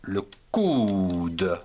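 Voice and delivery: long, low-falling ou in "coude"; teacher's word model. Shown as a demonstration. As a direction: draw out "coude" slowly for learners. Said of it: "vous avez cou, comme le coude".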